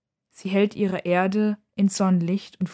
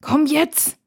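urging; 8 semitones higher, 4 dB louder than usual. A narrator's reading. Sister urges brother to come.

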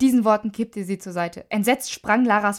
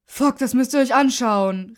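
loud, raised exclamation; excited call; 3 semitones higher, 5 dB louder than usual. A narrator's reading. Boy with phone calling his friends, excited. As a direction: call your friends over urgently. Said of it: "rief Max".

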